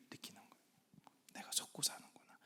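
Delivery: barely voiced hush, dropping almost to nothing; whisper. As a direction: breathe it like a secret realization. Preacher drops to a whisper.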